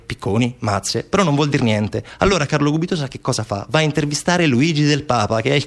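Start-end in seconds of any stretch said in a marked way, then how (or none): none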